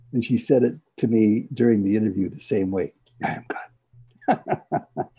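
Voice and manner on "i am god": barely breathed, very slow and hushed; a shy, near-silent whisper of the words; almost inaudible whisper. Retold or imitated. Speaker imitating her frightened whisper to Baba, near-silent whisper. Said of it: "she whispered almost inaudibly".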